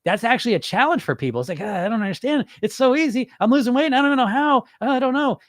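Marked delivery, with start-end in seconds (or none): 1.6-5.4 high-pitched mock bewilderment; put-on lost, puzzled client; disoriented dieter. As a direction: whine in baffled confusion. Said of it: "you could tell they're disoriented".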